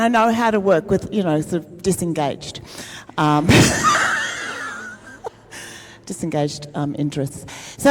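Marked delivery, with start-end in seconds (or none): 3.5-4.9 a sudden high burst of laughter; big surprised laugh. Real, not acted. Laughs at own joke.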